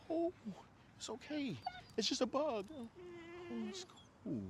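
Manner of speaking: high, soft consoling coo; imitated soothing mom. Mom comforting Freedom over a bug, high soft mock soothing. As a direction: imitate a mother cooing over an upset child. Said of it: "she's like".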